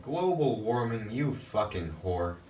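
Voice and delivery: usual speaking voice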